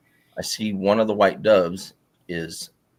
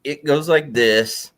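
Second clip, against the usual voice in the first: slow drawn-out demonstration; 9 semitones higher, 8 dB louder, much slower than usual. Retold or imitated. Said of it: "acting out the creature".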